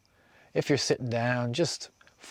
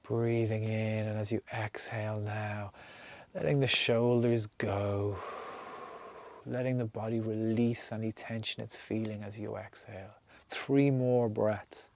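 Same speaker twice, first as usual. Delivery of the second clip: guided meditation; slower than usual. Acted. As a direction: lead them calmly from breath to breath. Leads a breathing meditation.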